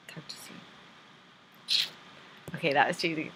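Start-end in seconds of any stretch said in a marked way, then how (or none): 0.1-0.6 whisper. Real, not acted. Tender sign-off to a friend.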